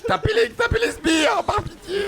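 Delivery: high, begging cry; pleading shout. Real, not acted. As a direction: beg them to hit the minions. Caster begging team.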